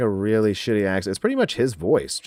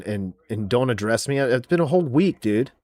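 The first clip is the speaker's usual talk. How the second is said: ordinary talk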